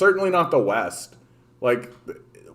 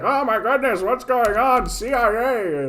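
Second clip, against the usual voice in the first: much higher mock-panicked voice; panicked viewers; 10 semitones higher than usual. Viewers imagining a CIA takedown, high mock panic. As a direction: squeal it like a worried fan. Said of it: "everybody will be like".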